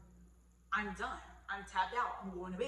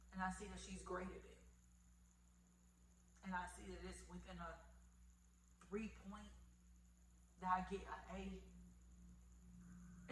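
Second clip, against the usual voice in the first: hushed awe; 3 semitones lower, 13 dB quieter than usual. Real, not acted. Awed hushed recall.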